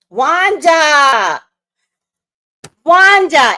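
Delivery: loud, high calling of the name; loud shout of the name. Real, not acted. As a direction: call the name out across the room.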